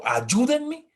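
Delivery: imitating a child yelling help; child screaming help. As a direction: shout it like a scared child. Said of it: "a un niño gritando".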